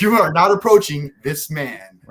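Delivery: loud, high, slow and emphatic order; hammed-up jealous boyfriend laying down the law; mock stern forbidding. Fighter retelling how he stopped his girlfriend approaching, loud mock outrage.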